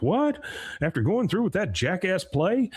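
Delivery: retelling a dad's shocked outburst; incredulous dad, high and sharp; surprised. Retold or imitated. Dad reacts to failed history.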